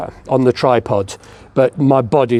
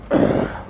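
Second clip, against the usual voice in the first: strained grunt while lifting; effortful grunt; 3 dB quieter than usual. Real, not acted. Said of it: "excuse me grunting".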